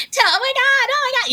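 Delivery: very high squealing excitement; imitating castmates squealing; thrilled gossip. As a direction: gush over the big news. Castmates gossip after the freeze.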